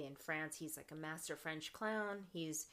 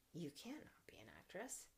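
whisper; 13 dB quieter than usual. Retold or imitated. Retelling mentor Marge's words.

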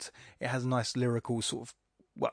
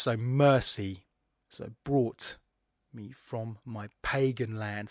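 slow reading, leaning on mercy and brought; reading the stressed syllables; slower than usual. Shown as a demonstration. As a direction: land each stressed beat slowly. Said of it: "we look at the really stressed".

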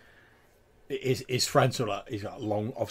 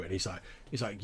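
ordinary talk

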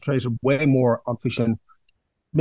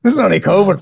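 loud, higher mock outrage; mock indignation; 6 semitones higher, 6 dB louder than usual. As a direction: protest it like a wounded patient. Mock outrage at man flu jibe.

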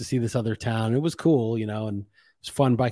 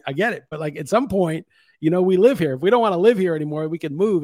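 heated rant; 7 semitones higher than usual. Real, not acted. Ranting about touring cemeteries.

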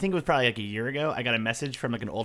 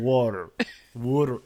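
attempted Philly accent. Shown as a demonstration. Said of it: "I can't really do a Philly".

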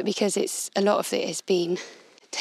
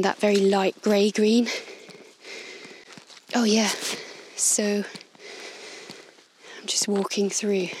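normal talking voice

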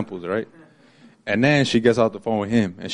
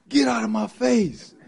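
mock high-pitched shriek, much higher; imitating mom's shriek; 12 semitones higher than usual. Retelling his mom's outburst, a mock high shriek. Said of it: "She yells, like, she shrieks".

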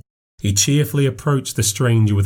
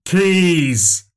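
insisting; 7 semitones higher, much slower than usual. Acted. Stranger insisting, stretched words.

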